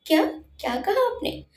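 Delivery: high, breathless happy surprise; happy disbelief. A narrator's reading. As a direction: catch your breath in joy. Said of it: "खुश होकर बोला". (ऊँची आवाज़ में खुशी भरा अचरज)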